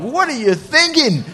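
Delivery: high, slow disbelieving question; incredulous. Retold or imitated. Watching a tiny dog pick a fight, incredulous. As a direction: marvel at the reckless little dog. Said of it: "I'm like".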